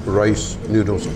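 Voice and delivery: normal talking voice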